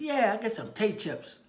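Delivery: put-on high, loud small-child voice; small boy making a request; little boy. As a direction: pipe up like an eager kid. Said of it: "there's a little boy there".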